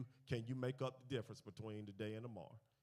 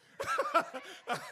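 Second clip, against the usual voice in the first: big high burst of laughter; sudden loud laugh; 12 dB louder than usual. Real, not acted. Laughs at own story.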